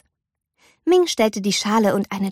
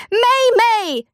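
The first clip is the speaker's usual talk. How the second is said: calling; 13 semitones higher than usual. A narrator's reading. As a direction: call through the door. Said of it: "rief ihre Mutter".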